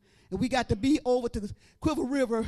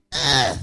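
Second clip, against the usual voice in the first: straining grunt; 10 semitones lower, 8 dB louder than usual. Retold or imitated. Straining to rise from bed.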